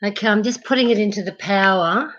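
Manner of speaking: ordinary delivery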